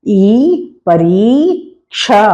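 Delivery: the ई sound, then "परीक्षा" drawn out slowly; slow spelled-out reading. Shown as a demonstration. Teacher sounding out a word for pupils, slowly. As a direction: say "ई" and stretch "परीक्षा". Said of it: "र में बड़ी ई की मात्रा".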